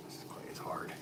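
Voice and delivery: voiceless whisper; just breathe it, no voice at all. Real, not acted. Potter complaining to viewers about stiff clay, whispered.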